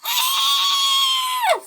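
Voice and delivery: long, high-pitched shriek; terrified scream. A narrator's reading. Millie as pink engine flies through, terrified scream. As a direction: scream in terror. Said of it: "screamed Millie in terror".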